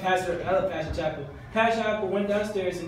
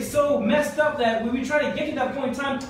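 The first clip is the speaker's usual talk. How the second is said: usual speaking voice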